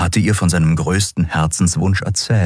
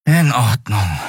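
mildly resigned, a little higher; resigned sigh; 5 semitones higher, slower than usual. Acted. Miko accepts losing the card game, resigned. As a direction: give in with a sigh. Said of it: "seufzte er resigniert".

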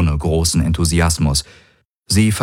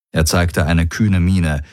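usual speaking voice

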